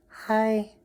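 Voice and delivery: high squeaky pretend cat hello; voicing the cat. Speaking for her cat Darla, high pretend voice. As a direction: answer for the cat in a tiny voice.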